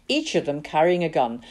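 usual speaking voice

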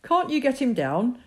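a father yelling up a tall tree; shouting up. Acted. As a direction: call it up into the tree. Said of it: "his father was shouting up to him".

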